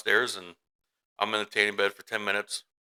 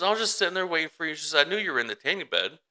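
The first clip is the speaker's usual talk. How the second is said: lightly voiced child, higher and quick; child explaining; 8 semitones higher, faster than usual. Mother quoting her daughter's explanation, lightly voiced child, higher and quick. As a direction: imitate a daughter's hurried explanation, pitched up.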